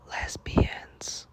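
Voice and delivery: hushed whisper on one word. Real, not acted. Whispering the word to her viewers. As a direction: drop to a whisper for the scandalous word.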